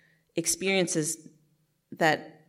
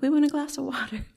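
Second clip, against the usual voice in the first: put-on much higher, small child asking; little kid voice; 10 semitones higher, 4 dB louder than usual. Toddler siblings asking big sister, high little-kid voice. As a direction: pitch it way up like a toddler pleading.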